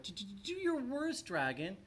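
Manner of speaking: high, stammering timid knight; scared stutter. A narrator's reading. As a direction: stammer bravely but scared.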